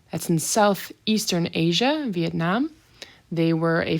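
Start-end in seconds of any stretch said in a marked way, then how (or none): none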